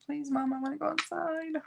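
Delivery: mock-crying dog voice, high and soft; Bailey. Upset dog begging Mom to go outside, in a high, soft mock whimper. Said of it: "She's crying".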